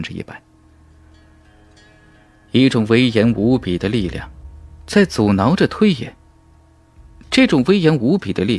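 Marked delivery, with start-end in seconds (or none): none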